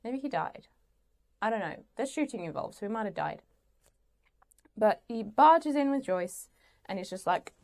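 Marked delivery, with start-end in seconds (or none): none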